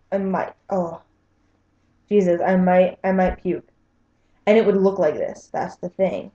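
normal talking voice

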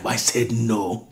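ordinary talk, nothing marked

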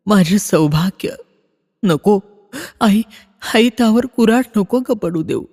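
high, pleading cry to the gods, slowing at times; desperate prayer. Acted. Mother praying before the gods to save her husband, pleading high. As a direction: beg the goddess for your husband's life.

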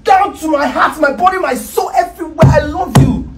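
high, fervent outpouring; urgent, emotional insistence; impassioned declaration. Acted. Impassioned declaration of love in quarrel.

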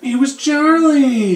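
loud, high, drawn-out exclamation; excited outburst. Pretend. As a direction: blurt it out high and slow, hammed up. Mock outburst over Charlie role.

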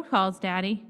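ordinary delivery, nothing marked

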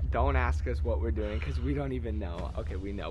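hushed early morning voice; quiet. Real, not acted. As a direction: keep it low, people sleeping.

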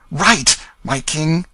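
rising eager call, slow; a boy's eager answer building up; eager cry. A narrator's reading. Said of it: "cried Tom Canty".

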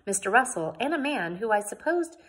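usual speaking voice

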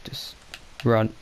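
ordinary delivery

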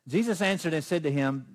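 normal talking voice

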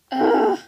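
overdone, very loud ugh, pitched high; dramatic groan. Acted. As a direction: groan like a soap-opera heroine. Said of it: "that one's a little bit too dramatic".

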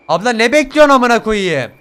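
loud irritated shout; annoyed yell. Real, not acted. Gamer yells impatiently at the woman, annoyed. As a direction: snap at her impatiently.

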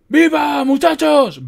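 high, very loud battle cry; full-voiced commander's roar; bellowing. A narrator's reading. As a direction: bellow to rally the men. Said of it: "bramó, agitando su bastón".

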